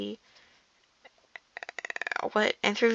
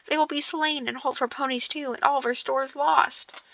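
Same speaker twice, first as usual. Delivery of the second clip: higher, understated lament; a touch of despairing complaint; mild moan; 4 semitones higher, 3 dB quieter than usual. A narrator's reading. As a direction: moan lightly over what will be lost. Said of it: "moaned the others".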